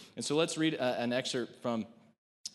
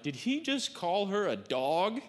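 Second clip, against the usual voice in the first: mock shock; 7 semitones higher, slower than usual. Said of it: "you're like".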